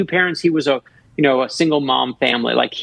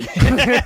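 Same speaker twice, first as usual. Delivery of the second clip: bright laugh; 10 semitones higher than usual. Real, not acted. Laughs after the punchline.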